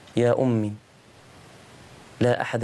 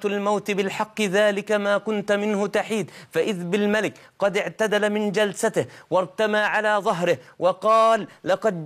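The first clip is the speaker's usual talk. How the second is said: higher, rising sermon voice at full force; impassioned preaching; 8 semitones higher than usual. Acted. Preacher telling the congregation the dying boy's story, impassioned preaching.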